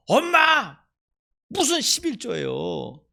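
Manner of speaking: put-on high, loud furious outburst of a boy; angry child. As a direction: shout back at mother in anger. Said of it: "막 화를 냈다는 거야".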